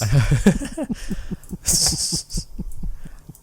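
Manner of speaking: breathless helpless laughter; laughing. Real, not acted. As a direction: wheeze with laughter. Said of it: "Finn, you cannot do that voice".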